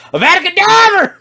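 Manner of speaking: high, loud Southern holler; full-lung mock Southern shriek; screamed Southern accent. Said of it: "screams at the top of his lungs in his best Southern accent".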